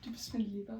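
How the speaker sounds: very soft, shy German phrase; too quiet. Pretend. Asked by co-host to talk German, very quietly. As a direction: murmur the German barely audibly. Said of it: "Well, louder. They're, they're not catching that".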